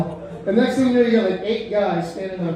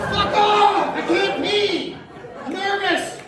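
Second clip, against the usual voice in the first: high, strained comic yell; drawn-out, high-pitched frantic protest; panicked outburst; 10 semitones higher, slower than usual. Retold or imitated. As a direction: cry it out high in mock desperation. Acted outburst of the nervous peer.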